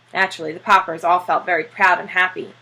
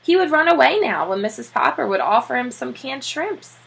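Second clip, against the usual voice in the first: ordinary delivery, nothing marked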